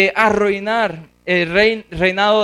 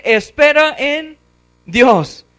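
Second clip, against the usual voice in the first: higher, drawn-out urging of his own soul; slow, raised self-exhortation; preaching to himself; 7 semitones higher, 4 dB louder, much slower than usual. Acted. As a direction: exhort yourself to hope. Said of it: "Está predicando ahora a sí mismo".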